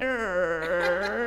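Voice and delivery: long drawn-out creaking noise; imitated door creak. Speaker retelling a door opening at night, creaking noise. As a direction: mimic a slowly creaking door, softly. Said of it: "it went".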